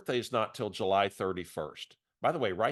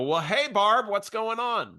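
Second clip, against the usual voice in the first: much higher, louder cheery hello; pitched-up gushing hello to a stranger; overeager greeting; 11 semitones higher, 6 dB louder, slower than usual. Retold or imitated. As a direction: answer like she's an old friend. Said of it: "Don't judge me for answering like I do".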